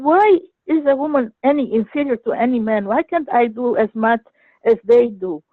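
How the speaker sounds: normal talking voice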